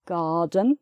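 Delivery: "garden" as "gaah-den", silent R, rising at the end; non-rhotic "garden" with long first vowel; open "ah" vowel. Shown as a demonstration. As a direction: say "garden" the British way without the R. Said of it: "so just the R sound again".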